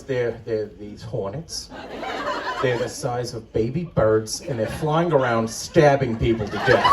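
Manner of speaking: low hushed dread as the mother describes the hornets; imitating mom. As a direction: mom whispering a horror story. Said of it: "She goes".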